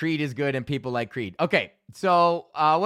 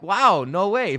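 amazed; 5 semitones higher, 5 dB louder, slower than usual. Retold or imitated. Amazed at discovering rock.

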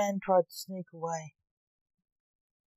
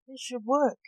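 higher, louder mild story shout; 6 semitones higher, 6 dB louder than usual. A narrator's reading. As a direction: raise the voice in frustration. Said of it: "shouted the man".

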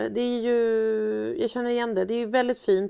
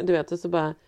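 ordinary talk

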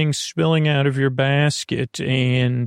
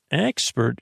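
ordinary talk

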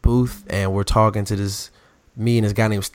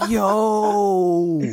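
stunned yo; 10 semitones higher than usual. Retold or imitated. His own stunned reaction to the lady.

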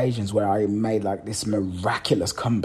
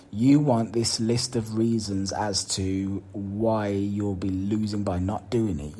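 normal talking voice

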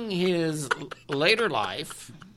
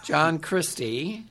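slow, deliberate, every syllable spelled out; over enunciating; slower than usual. Real, not acted. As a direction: spell each name out slowly. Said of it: "Why are you talking slow for me?".

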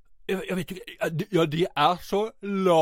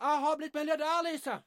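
very high, strained cry from off mic; high-pitched yelling from a distance; desperate distant calling; 10 semitones higher, 6 dB quieter than usual. Acted. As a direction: call out a last plea to an ex. Last plea to ex while cut off.

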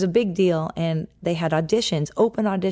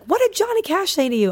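high-pitched retold excited demand; excited questioning; 12 semitones higher than usual. Grandmother rushing over to the girl, high excited questioning. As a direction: imitate an eager, astonished questioner.

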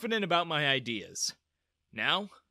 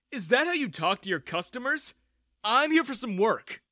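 loud, high indignant protest; 9 semitones higher, 6 dB louder than usual. A narrator's reading. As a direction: push back hard at the insult. Arguing with sneering guard.

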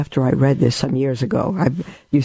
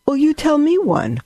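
much higher, teasing offer; playful teacher; 13 semitones higher than usual. Retold or imitated. Teresa teasing the children about stories: lightly voiced, higher. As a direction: toss the question back lightly. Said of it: "And she said".

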